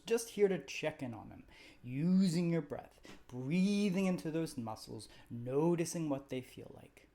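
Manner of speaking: calm, even meditation guide voice; guided meditation. Acted. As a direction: gently lead the listener through the body scan. Leads a guided body scan.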